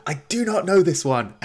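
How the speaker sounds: hammed-up desperate yell, way up in pitch; Frank yelling. Frank panicking on stage when an unknown song plays, yelling. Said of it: "starts yelling".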